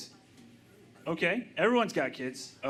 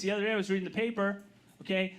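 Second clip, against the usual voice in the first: ordinary talk, nothing marked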